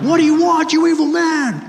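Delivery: high, loud hammed-up young hero; Luke. Hero confronting the villain on the Death Star, high-pitched Luke. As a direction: pitch it way up, a mock yell at the villain. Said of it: "epic scene between Luke and Darth".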